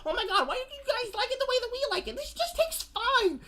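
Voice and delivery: mocking high voice. Mocking filmmakers' voice.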